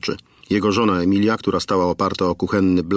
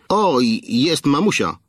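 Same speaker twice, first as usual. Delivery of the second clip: jeering, pitched higher; mocking tone; 7 semitones higher, slower than usual. A narrator's reading. Kidnapper mocking the mother over the phone, sneering. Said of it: "odezwał się wreszcie drwiącym tonem".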